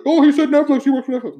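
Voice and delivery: high, loud mock listener; mock viewer. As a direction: squeak out a nosy viewer's gotcha. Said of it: "wants to be like".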